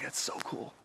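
hushed, whispery imitation of awe; awed kid. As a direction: whisper it like an amazed boy. Boy awed by the red Viper.

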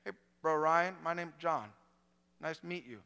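halting greeting with words dropped and gaps between; halting impaired speech. Retold or imitated. Recovering preacher greets the student, halting and broken. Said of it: "he talked like this".

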